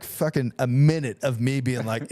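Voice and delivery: ordinary talk, nothing marked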